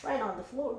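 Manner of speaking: ordinary delivery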